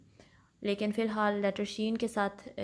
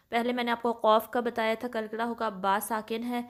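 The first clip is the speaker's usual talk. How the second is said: usual speaking voice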